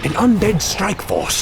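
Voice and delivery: surprised. Acted. Sudden sight of enemy troops.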